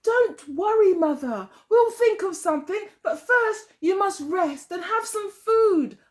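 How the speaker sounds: high, soothing daughter voice; calm mother down gently; reassuring daughter. Acted. Daughter reassuring her frightened mother, high and gentle. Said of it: "answered her daughter".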